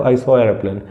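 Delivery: ordinary talk, nothing marked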